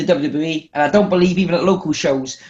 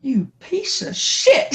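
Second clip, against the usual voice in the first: disbelief; 11 semitones higher, 3 dB quieter, much slower than usual. Retold or imitated. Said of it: "I was like".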